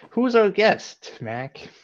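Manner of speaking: high, loud, drawn-out host question; show host intro. Acted. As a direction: build it up like a game show. Introducing the guest show-style.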